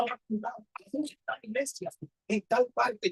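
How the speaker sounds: slow conspiratorial whisper; retold secret whispered slowly. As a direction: breathe it like a secret plan, slowly. Secret plan to sneak off.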